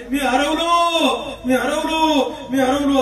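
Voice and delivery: high, drawn-out mock wail; imitated wailing cry. Nephew imitates his uncle's market cries for his aunt, mock wail. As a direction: imitate someone calling out that he's lost. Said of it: "माझे काका बाजारामध्ये मोठ्याने ओरडतात".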